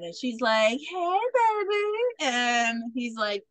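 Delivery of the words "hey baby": high, slow, drawn-out come-on; mock flirty greeting. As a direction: imitate a flirt, pitch sliding up. Hot actress greets Wyatt flirtatiously.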